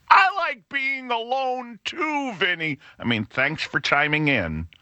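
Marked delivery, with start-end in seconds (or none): 0.1-2.8 higher, slow, syrupy parody of a commenter; gently mocking a listener who wrote a long comment. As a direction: lightly mimic a know-it-all listener. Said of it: "write a huge paragraph about".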